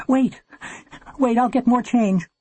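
high, flustered interruption; high-pitched plea to hold on; urgent plea. A narrator's reading. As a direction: stop the operator urgently. Mother pleads with operator for time.

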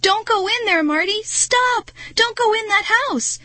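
high, loud panicked shout; frantic calling. A narrator's reading. Girl calling after Marty to stop, frantic. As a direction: yell a desperate warning after someone. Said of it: "frantically calling after Marty".

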